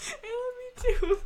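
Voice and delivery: high, crying I love you too; teary. Real, not acted. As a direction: say it through happy tears. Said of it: "Your face is all red".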